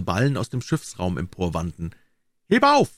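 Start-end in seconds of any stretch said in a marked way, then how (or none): 2.5-2.9 high, loud work call; short heave-ho cry; sailors' shout. A narrator's reading. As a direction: call out like sailors hauling a rope. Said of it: "schrien sie bei jedem Ruck am Tau".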